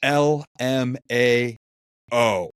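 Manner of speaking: letter by letter, slow and loud; slowly spelling a text reply; spelled out slowly. Retold or imitated. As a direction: drag out each letter. Reads out friend's reply.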